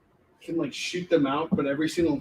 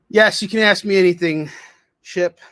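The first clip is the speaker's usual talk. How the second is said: ordinary delivery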